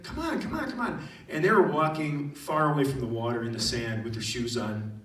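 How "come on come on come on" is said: high, rapid-fire coaxing; playful high-pitched pleading; eager urging. Retold or imitated. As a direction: beckon stragglers excitedly. Urging lagging students to follow.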